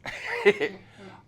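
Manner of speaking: high, loud burst of laughter; high amused laugh. Retold or imitated. Woman laughs at the preacher.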